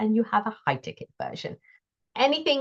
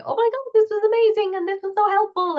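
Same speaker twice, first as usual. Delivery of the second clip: much higher, gushing mock client praise; 13 semitones higher, 3 dB louder, faster than usual. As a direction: imitate thrilled clients raving, pitched way up. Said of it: "all these people coming and saying".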